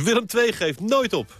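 mild mock battle cry, not shouted; rallying cry. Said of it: "al weken roept".

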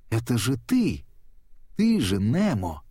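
mild, narrated cry of recognition; excited yell. A narrator's reading. Dori recognises Nemo, mildly yelling in excitement. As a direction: exclaim it in amazed discovery. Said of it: "завопила Дори".

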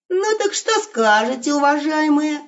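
high bold call-out. Acted. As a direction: call out cheekily. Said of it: "снова выкрикнул он". (дерзкий выкрик волка)

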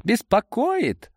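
high, drawn-out outburst without extra volume; crying out. A narrator's reading. Boris objects to Amadei's taunt, crying out high. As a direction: cry it out high and slow. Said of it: "вскрикнул тот".

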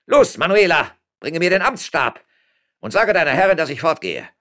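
loud, high, blustering official; domineering mayor. Acted. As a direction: assert your authority and give orders.

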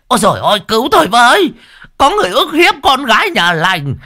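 high, loud falsetto wailing for help; a man shrieking as a damsel in distress; shrill fake woman. A narrator's reading. Old man in drag shrieking to villagers, loud falsetto. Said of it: "vừa chạy vừa ngoáy đít, vừa ngoạc mồm".

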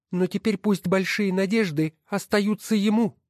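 high, scornful woman's voice; wife's voice. Acted. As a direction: sneer at each title as you sort them. Wife voiced dividing the books. (высокий насмешливый голос жены)

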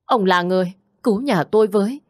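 kneeling husband begs the doctor, near tears; tearful pleading. A narrator's reading. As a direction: beg on your knees, whimpering. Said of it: "quỳ xuống mếu máo".

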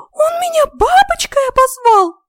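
very high, loud, drawn-out fairy wail; hurt, squeaky indignation; offended fairy. A narrator's reading. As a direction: squeal the complaint like a slighted tiny fairy. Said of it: "обиженно отозвалась она".

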